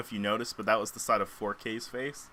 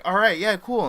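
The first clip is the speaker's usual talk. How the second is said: eager agreement; 9 semitones higher, 8 dB louder than usual. Pretend. The friends agreeing on a plan.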